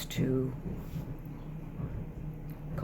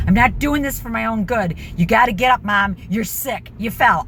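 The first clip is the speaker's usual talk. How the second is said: loud, high exasperated yelling at mom; pleading at full volume to get up; retold screaming; 5 semitones higher, 11 dB louder than usual. Yelling at mom to get out of bed, loud. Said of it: "I started screaming".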